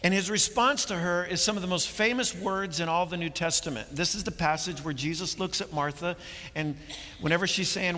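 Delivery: ordinary talk, nothing marked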